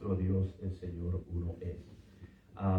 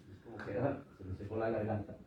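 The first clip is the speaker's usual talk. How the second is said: usual speaking voice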